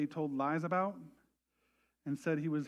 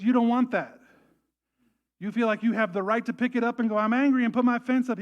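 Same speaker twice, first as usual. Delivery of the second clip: louder, higher, pressing plea; urgent pleading; 6 semitones higher, 8 dB louder than usual. Retold or imitated. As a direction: urge him to drop it. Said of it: "He goes".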